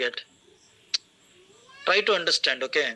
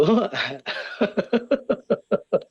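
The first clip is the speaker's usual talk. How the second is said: a real burst of laughter at a student's emoji; hearty laugh; 3 semitones higher than usual. Real, not acted. Teacher laughing at a student's emoji, hearty laugh. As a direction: let a warm laugh roll out and fade.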